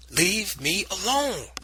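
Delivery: high, slow and drawn-out yell; scream. Acted. Boy fighting aunt, screaming to be left alone. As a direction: scream it at the top of your voice. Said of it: "I screamed at the top of my voice".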